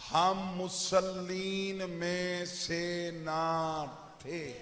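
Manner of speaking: very slow, each word stretched out; drawn-out emphasis. Acted. Preacher spelling out the verse's meaning to his gathering, drawn out. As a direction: stretch every word of the translation.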